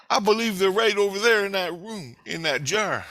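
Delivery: loud, high-pitched retold insistence; emphatic accusation. Intruder tells scientist where seeds are, emphatic accusation. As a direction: point out the evidence forcefully.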